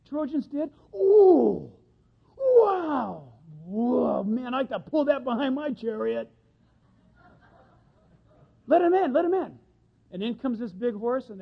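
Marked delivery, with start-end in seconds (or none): 0.9-6.3 excited awe. Acted. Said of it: "really getting excited". 8.7-9.6 high, loud, eager call; hammed-up eager shouting; excited crowd shout. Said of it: "really getting excited".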